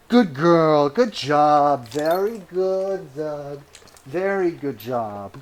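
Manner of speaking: slow, drawn-out doggy praise; praising a dog. Real, not acted. Owner praises Doug after the trick, slow cooing praise. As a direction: croon it slowly to a good dog.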